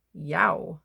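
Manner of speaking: ordinary delivery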